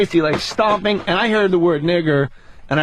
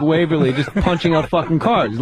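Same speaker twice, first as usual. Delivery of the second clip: ordinary talk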